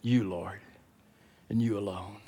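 low, soft, deliberate confession; emphatic answer, starting low. Acted. Peter answering his Lord, hushed and emphatic. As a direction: begin softly, setting "You" apart. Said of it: "He said it like this".

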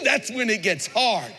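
ordinary talk, nothing marked

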